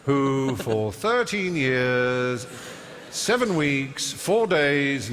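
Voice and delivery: slow, emphatic recital of a record; know-all recitation. Retold or imitated. Lippy young know-all reciting Guinness records to everyone, slow declamation. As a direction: declaim each figure with relish, drawn out. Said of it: "So I was able to say".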